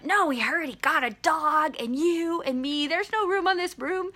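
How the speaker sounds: high, complaining cat voice; protesting cat. Retold or imitated. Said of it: "cat's over there going".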